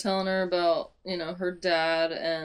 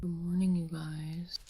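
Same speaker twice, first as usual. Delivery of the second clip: whispered greeting. Real, not acted. Vlogger whispers good morning to the viewers. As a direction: breathe it out in a whisper. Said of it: "whispered morning greeting".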